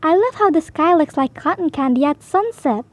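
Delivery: ordinary delivery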